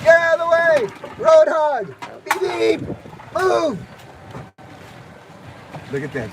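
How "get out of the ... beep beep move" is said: high playful yell at a road hog; mock road rage. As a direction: shout at the cart ahead, hammed up. Yelling at cart in the way.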